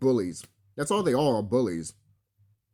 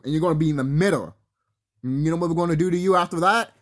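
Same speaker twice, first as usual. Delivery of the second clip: normal talking voice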